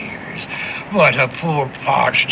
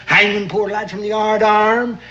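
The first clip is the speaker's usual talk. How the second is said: ordinary delivery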